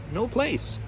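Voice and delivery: ordinary delivery, nothing marked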